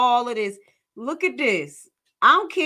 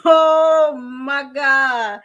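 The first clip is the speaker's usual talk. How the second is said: slow laughing exclamation, louder; laughing oh my God; 4 semitones higher, 6 dB louder, much slower than usual. Real, not acted. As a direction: laugh through the words. Laughing reaction to clip.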